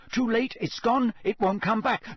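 high, halting recitation; horror-struck recital. A narrator's reading. Harry recalling the clue before the elf, horror-struck. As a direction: recite the rhyme as dread dawns. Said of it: "Harry recited, staring horror-struck".

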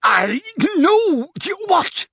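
high, strangled rogue spluttering. A narrator's reading. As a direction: splutter it slowly as if held by the throat. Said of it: "spluttered Mundungus".

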